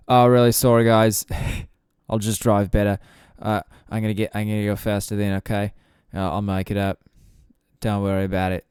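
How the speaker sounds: put-on breezy Australian accent, loud and cheerful at the start; Daniel Ricciardo, Australian accent. Australian driver shrugs off radio penalty, breezy Australian accent. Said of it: "that's why I'm doing that, accent".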